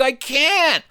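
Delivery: put-on very high-pitched girl voice; imitated high, anxious refusal; distressed protest. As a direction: protest like a cornered child. Girl protests under pressure.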